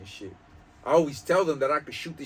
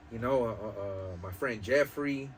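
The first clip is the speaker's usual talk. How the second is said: ordinary talk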